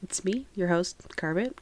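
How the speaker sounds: ordinary talk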